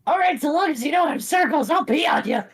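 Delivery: high, squeaky cartoon bird; high-pitched bird laying down terms; Skarmory voice. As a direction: agree in a silly high voice. Skarmory answers invitation to join.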